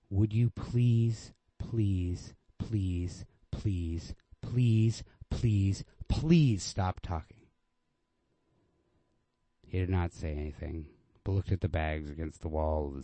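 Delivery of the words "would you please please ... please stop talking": slow, repeated pleading please; weary begging. Acted. Girl begging the man to stop talking, slow weary pleading. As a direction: beg him to stop, slow and tired.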